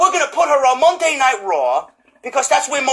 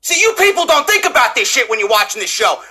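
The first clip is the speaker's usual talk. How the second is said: ordinary talk, nothing marked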